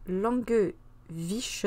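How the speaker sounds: Langewische said very slowly, syllable by syllable; hesitant, drawn-out Langewische; careful spelling-out of a foreign name. Shown as a demonstration. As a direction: slowly sound out Langewische like a name you can't pronounce. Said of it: "pro-, nom imprononçable".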